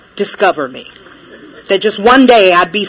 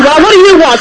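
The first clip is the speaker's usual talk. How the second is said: higher, louder squeaky clerk voice; the little drive-thru guy snapping at her, higher and louder; 6 semitones higher, 4 dB louder than usual. Retold or imitated. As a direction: mimic the impatient little guy in the speaker box. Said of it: "don't ask me".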